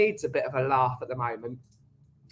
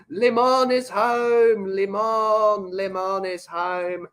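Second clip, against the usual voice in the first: higher, slow sing-song announcement with a put-on twist; playful announcing voice; 4 semitones higher, much slower than usual. Streamer greeting moderator Lemon in chat, lightly put-on sing-song announcement. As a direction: herald her arrival like a jokey fanfare.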